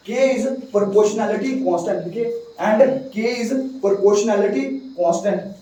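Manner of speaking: slow dictation pace; slowly, word by word for notes; dictating. Shown as a demonstration. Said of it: "के भी बहुत ज्यादा important है".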